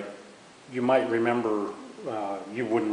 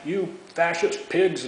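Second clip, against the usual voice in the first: higher, slow, jeering chant; mock protester yell; 8 semitones higher, 4 dB louder, slower than usual. Protesters yelling at returning soldiers, jeering. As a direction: sneer it like a protester heckling soldiers. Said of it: "these people are yelling".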